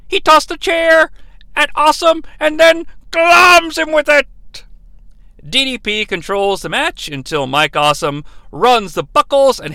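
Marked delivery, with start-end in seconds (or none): 0.1-4.3 high, loud excited commentator call; Tony Schiavone. Retold or imitated. Recapper quoting the announcer's call of the move, excited shout. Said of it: "This move is called by Tony Schiavone".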